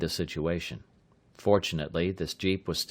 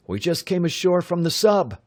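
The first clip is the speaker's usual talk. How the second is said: put-on higher, eager young voice; young man voice; 10 semitones higher, 5 dB louder than usual. A narrator's reading. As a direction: sell the lie brightly. Bud plays a frogman.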